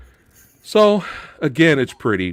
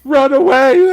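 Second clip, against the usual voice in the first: high, loud, drawn-out mock cry; hammed-up shout to the troops; mock panicked yell; 9 semitones higher, 8 dB louder, much slower than usual. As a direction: yell it like a fleeing coward. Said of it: "he's literally going".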